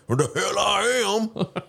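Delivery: mocking the pie company owner in an Alabama accent. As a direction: put on a thick Alabama accent, slow and high. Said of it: "I just put an Alabama accent on".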